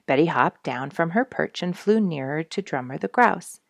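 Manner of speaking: plain reading voice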